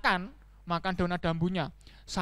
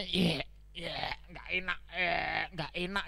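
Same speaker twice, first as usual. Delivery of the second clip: low, soft groan of distaste; understated yuck; disgusted; 4 semitones lower, 9 dB quieter, slower than usual. Acted. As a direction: grumble quietly at a nasty taste. Donkey tastes worms, disgusted.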